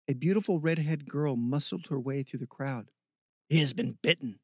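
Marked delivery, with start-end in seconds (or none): 3.5-4.4 lightly roughened character voice; a touch of roughness; rough voice. A narrator's reading. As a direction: report it in a rough voice. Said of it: "a rough voice said".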